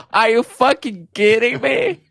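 slow, incredulous, hammed-up outrage; outraged disbelief. Shown as a demonstration. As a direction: stretch it out in mock disbelief. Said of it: "Much better".

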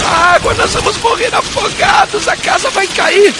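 put-on frightened child. A narrator's reading. Little sister to her brother during the flood, panicked. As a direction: cry out in terror that we'll drown.

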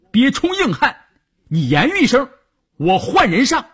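impatient rebuke. A narrator's reading. Commander pressing Guan by phone.

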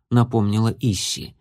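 ordinary delivery

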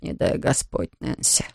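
put-on whispered old man's mutter. A narrator's reading. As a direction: mutter it under your breath. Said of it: "пробормотал он".

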